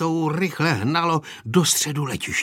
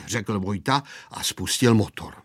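usual speaking voice

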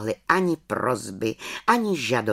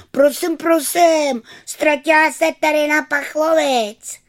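put-on tattling children; 14 semitones higher, 7 dB louder than usual.

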